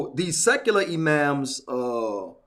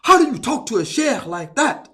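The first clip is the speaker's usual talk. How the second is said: raised, louder mock outrage; indignant outburst; 5 semitones higher, 5 dB louder than usual. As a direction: scold as if scandalised by disrespect.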